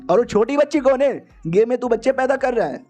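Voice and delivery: high-pitched retold jeer; mocking taunt. Gang member taunts about the child.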